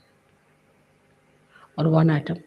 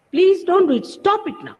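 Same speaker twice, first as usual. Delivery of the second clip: stern plea; 7 semitones higher than usual. Real, not acted. Pleading with followers to stop arguing.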